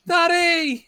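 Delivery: put-on high, loud squeal of a kid calling out; mock child's excited cry. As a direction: shout it like a kid running to a parent. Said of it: "loads of kids running up going".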